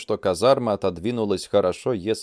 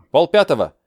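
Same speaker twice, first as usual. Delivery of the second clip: other soldier voice; 6 semitones higher, 6 dB louder than usual. Acted. Soldier answers the time.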